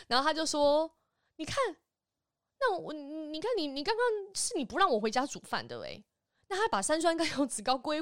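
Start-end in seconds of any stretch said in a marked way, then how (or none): 1.4-6.0 higher, complaining mother voice; mock accusing mom; imitating mom. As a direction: mimic a mother blaming her child.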